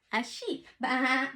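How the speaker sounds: ordinary delivery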